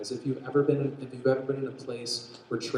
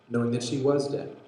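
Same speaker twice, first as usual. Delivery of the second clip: ordinary talk, nothing marked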